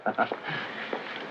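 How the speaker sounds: quiet, breath-only laugh; soft chuckle. Acted. Man amused before his butler joke, soft chuckle. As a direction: chuckle softly to yourself.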